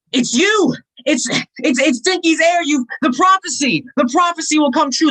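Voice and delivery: high, thrilled gasping recognition; excited. Acted. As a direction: burst out in awed excitement. Recognizing the prophesied heir.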